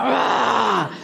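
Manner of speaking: a shouted "rah" roar. Acted. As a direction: let out a sudden throaty outburst.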